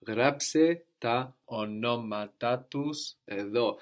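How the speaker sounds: γράψε τα ονόματά τους εδώ said slowly, both accents in ονόματά marked; slow model. Shown as a demonstration. Teacher modelling the Greek sentence slowly. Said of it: "two accents".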